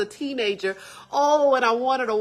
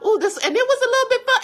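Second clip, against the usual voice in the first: excited; 13 semitones higher, 5 dB louder, faster than usual. Retold or imitated. Excited self-talk, then laughing.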